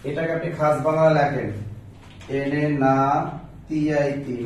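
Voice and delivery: ordinary talk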